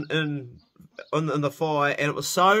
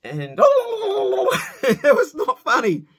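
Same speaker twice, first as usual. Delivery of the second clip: words squeezed out through laughter; can't stop laughing while telling it; real laugh breaking the line; 10 semitones higher, 5 dB louder, much slower than usual. Real, not acted. Laughing retelling of turkey fight.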